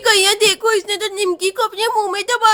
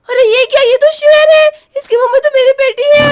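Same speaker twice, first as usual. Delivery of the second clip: panicked high shout, much louder; terrified shout; 5 semitones higher, 6 dB louder than usual. Acted. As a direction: scream in terror at the lion. Mother finds lion holding baby. (डर से ऊँची चीख)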